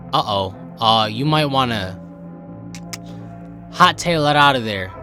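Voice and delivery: ordinary talk, nothing marked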